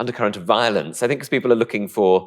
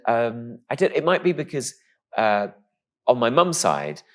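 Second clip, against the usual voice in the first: ordinary talk, nothing marked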